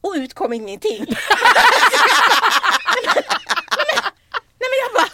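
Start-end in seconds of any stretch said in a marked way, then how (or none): 1.1-4.1 loud, high helpless laugh; real laughter spilling over; high burst of laughter. Real, not acted. Laughing at being speechless.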